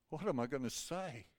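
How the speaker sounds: soft, helpless question to oneself; hushed self-questioning. Retold or imitated. Minister about to break bad news asks himself, hushed. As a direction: ask it under the weight of bad news, softly.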